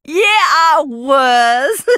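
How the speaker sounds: slow, cackling triumphant laugh; gloating laugh. Real, not acted. Gloating about hurting Clara, slow cackling laugh. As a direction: savour the cruel joke.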